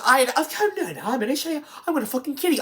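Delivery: very high, hammed-up mock persona; Mary Shelley. Reviewer showing the actress flipping personas, frantic high impression. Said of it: "she goes back and forth".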